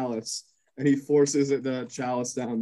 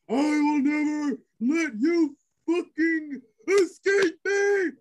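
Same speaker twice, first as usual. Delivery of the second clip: much higher, slow, strained heroic cry; Arnak's vow; 12 semitones higher, slower than usual. Acted. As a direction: drag out the vow like an anime hero. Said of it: "He goes".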